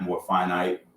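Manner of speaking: ordinary delivery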